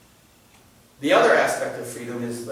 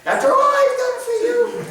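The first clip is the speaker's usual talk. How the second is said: much higher, shrill mock guilt trip; New York grandma; 5 dB louder than usual. Grandma guilt-trips her child, mock New York whine. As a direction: ham up the guilt-tripping grandma. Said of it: "She's from New York, apparently".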